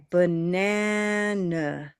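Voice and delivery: "banana" with a long held NAN; stressed syllable lengthened. Shown as a demonstration. Teacher demonstrating time in stress, long middle syllable. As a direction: shorten and reduce the outer syllables of "banana", stretch the middle. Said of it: "syllables become shorter in time".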